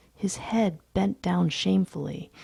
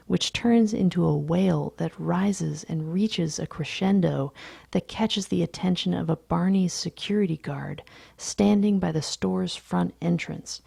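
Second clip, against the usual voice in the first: plain reading voice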